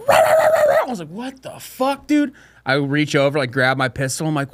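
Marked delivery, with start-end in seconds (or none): 0.1-2.3 startled. Retold or imitated.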